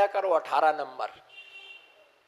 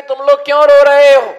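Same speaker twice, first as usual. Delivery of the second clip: loud, fast call higher up; 7 semitones higher, 14 dB louder, faster than usual. Retold or imitated. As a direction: sadhu calls across to the crying group. Sadhu calls out to weeping men.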